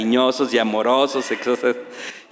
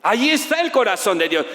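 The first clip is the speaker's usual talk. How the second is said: usual speaking voice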